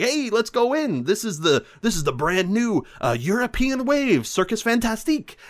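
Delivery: high, hammed-up eager pitch for the circus; Mike gushing. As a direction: sell the circus like a giddy himbo. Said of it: "Mike just goes off".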